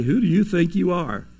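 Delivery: a hint of a snide comeback; mocking retort. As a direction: throw it back at someone with a sneer. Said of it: "You ever said that before to somebody?".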